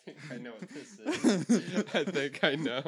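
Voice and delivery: giggling through the words; laughing. Real, not acted. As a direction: laugh while you say it. Guessing the answer, laughing.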